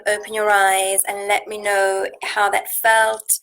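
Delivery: ordinary delivery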